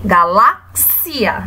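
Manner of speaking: slow "Galáxia", pausing before a drawn-out "xia"; last syllable separated. Shown as a demonstration. Teacher pointing out the last syllable, slow and separated. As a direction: split "Galáxia" as galá, then xia. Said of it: "a última sílaba é a sílaba cia".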